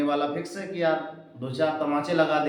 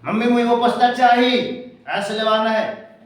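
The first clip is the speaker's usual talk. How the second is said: higher, louder bratty demand; rude demand; 5 semitones higher, 5 dB louder than usual. Retold or imitated. Child rudely demands a book from mother, loud and higher. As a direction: insist on the book like a spoiled kid. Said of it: "और उसकी जगह".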